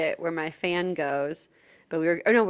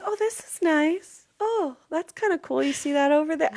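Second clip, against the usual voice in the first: fake interest; 7 semitones higher than usual. Retold fake interest in booth.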